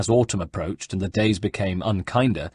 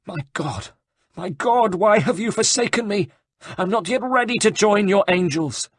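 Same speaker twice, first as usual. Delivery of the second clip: despairing wail; 11 semitones higher than usual. Acted. Abbot wails to God.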